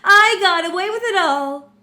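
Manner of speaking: high, loud crowing villain; unhinged villain. Acted. Villain boasting he got away with it, unhinged. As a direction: crow it like a cackling madman. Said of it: "even voicing him, like, makes me fucking cuckoo bananas".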